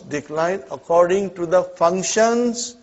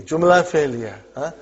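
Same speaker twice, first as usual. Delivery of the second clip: usual speaking voice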